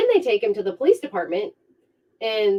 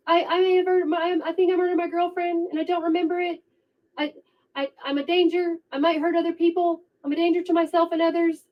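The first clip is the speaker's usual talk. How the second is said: mock panic; 9 semitones higher, 3 dB quieter, faster than usual. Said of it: "Jeff on the call".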